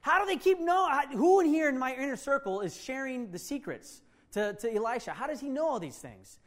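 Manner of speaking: wicked king furious about the leaks; angry king. Acted. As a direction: demand answers from the inner circle. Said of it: "was getting mad".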